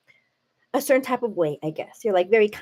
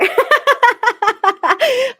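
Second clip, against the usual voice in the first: loud, high cackling laugh; big laugh; 14 semitones higher, 8 dB louder than usual. Real, not acted. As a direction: burst out in a long, high laugh. Laughing at a viewer's comment.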